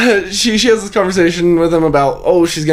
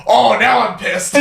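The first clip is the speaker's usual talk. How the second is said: imitating the turkey; 8 semitones higher than usual. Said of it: "I can't even do it the way he says".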